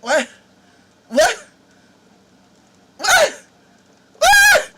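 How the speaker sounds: stunned then exploding; disbelief building to a shout. Acted. Tour guide stunned by question.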